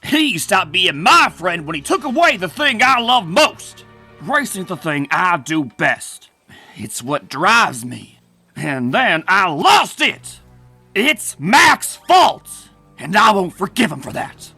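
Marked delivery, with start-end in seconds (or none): 0.0-3.9 raised, indignant outburst; wounded, accusing protest; angry grievance. Acted. Rival airs his grievance. 8.5-14.5 bitter, heated refusal to forgive; angry blaming. Acted. Rival blames Mac in quarrel.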